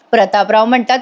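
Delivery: ordinary delivery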